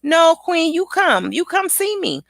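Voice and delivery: high, insistent kiddie voice; imitated insistent child. Child insisting Queen visit her, high imitated voice. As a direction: mimic a child demanding a visit. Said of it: "She said".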